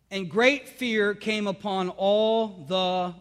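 slow, drawn-out scripture reading that stops for the crowd to answer; slow lead-in reading. Shown as a demonstration. Pastor reading Acts so the congregation fills in the word, slow and drawn out. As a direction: read very slowly, leaving it open for them to finish.

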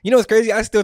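high loud exclamation; excited. Real, not acted. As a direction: burst out excited. Sudden exclamation.

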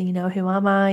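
usual speaking voice

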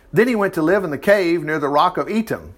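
ordinary talk, nothing marked

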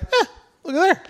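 high, smug show-off voice; high mocking boast. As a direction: strut it like a proud new rabbi. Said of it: "the human side of him has gotta be like".

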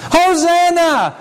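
drawn-out high Hosanna, slow; crowd crying out. Acted. Preacher voicing the crowd greeting Jesus, slow high cry. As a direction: call it out slowly like a cheering crowd. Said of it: "they began to cry out".

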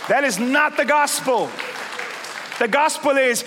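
fiery pastor charging the church to act; full-force preaching. Acted. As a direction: preach it hard to wake the room.

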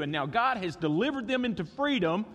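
ordinary delivery

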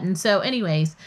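ordinary talk, nothing marked

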